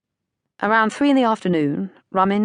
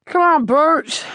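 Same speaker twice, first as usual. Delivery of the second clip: higher, louder, slow farmer's call; 11 semitones higher, 6 dB louder, slower than usual. A narrator's reading. Farmer calling his son down off the rick, loud slow call. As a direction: call the boy across the field, drawn out. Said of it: "Rummins called".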